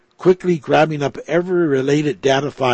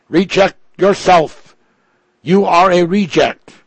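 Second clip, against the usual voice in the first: raised, loud and eerie, a strange new voice; grainy robot voice; 5 semitones higher, 6 dB louder than usual. A narrator's reading. Robot Ripples confronting its maker Piorti, in a raised eerie voice. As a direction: speak as an unfamiliar machine voice, high and loud. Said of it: "a voice quite granny but unfamiliar spoke".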